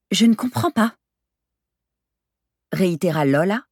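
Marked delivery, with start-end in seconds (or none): 0.1-0.9 higher-pitched, dumbfounded reply; baffled and thrown, pitch up; stunned. A narrator's reading. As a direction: say it in bewildered disbelief.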